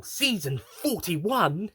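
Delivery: high, slow double take; a drawn-out doubting question; mock surprise. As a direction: stretch out forty-one in disbelief. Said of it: "Uh, yeah".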